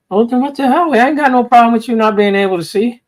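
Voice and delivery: high-pitched, imitating an eager date; mock eager acceptance. Retold date cheerfully accepts blind woman.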